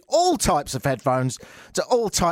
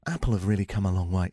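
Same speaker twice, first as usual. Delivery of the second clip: low, quieter imitation of a British guy; British presenter impression; 8 semitones lower, 3 dB quieter than usual. Host mimicking Apple's British guy, low impression. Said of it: "Is he the British guy that sounds".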